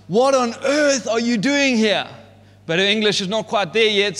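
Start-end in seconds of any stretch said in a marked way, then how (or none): 0.1-2.1 raised, higher mock scold; a fairly raised, higher mock shout at the kids; intended scream. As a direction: act out the yell she meant to give. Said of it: "she is meant to scream out".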